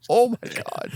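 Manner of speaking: high, laughing astonishment; mock amazement. Reacting to the Dorchester couple's accent, high mock amazement. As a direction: exclaim it high through amusement.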